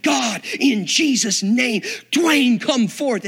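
slow, high, loud command; commanding prayer shout. Retold or imitated. Preacher commanding dead man to rise, loud slow shout. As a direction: call him out of death.

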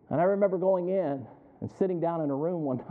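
usual speaking voice

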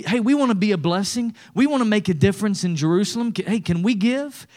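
eager churches. Retold or imitated. Poor churches begging Paul to let them give, eager.

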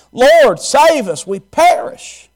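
high, loud, drawn-out plea; preacher acting out a desperate cry; urgent cry for help. Disciples crying out to Jesus.

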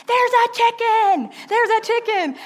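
high, loud screaming woman; mock scream. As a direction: scream it like a startled stranger. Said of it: "she immediately starts screaming".

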